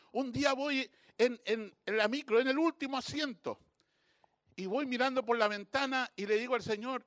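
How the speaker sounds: normal talking voice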